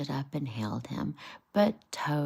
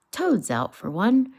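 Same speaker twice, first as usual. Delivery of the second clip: Otter's voice; 7 semitones higher, 8 dB louder than usual. Acted. Otter gossips about Toad.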